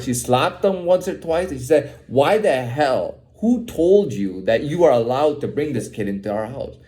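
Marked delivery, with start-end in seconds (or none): none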